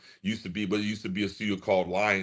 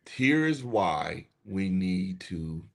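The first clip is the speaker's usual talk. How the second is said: slow calm explanation; 3 semitones lower, slower than usual. Retold or imitated. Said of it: "talking soft".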